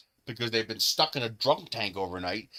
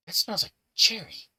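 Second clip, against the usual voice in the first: sniffing discovery; 8 semitones higher than usual. Retold or imitated. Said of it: "I was like".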